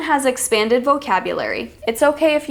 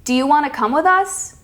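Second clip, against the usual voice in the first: higher-pitched question climbing to "us"; inviting question with a rise on "us"; rising intonation; 4 semitones higher than usual. Shown as a demonstration. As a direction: lift the end of the question on "us". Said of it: "That's rising".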